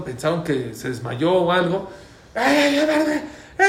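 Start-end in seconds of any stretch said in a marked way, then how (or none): 2.3-3.7 high-pitched frightened yelps, acted out; retold panic. Passengers on a runaway vehicle take fright, mock panic. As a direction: yelp like a startled passenger, in imitation. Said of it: "y se espantaron".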